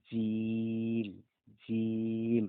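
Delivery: জিম drawn out long, then firmly; letter জিম modelled slowly for learners; drawn out jim. Shown as a demonstration. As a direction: hold জিম slowly, then say it again cleanly. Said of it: "এটার উচ্চারণ তিন র".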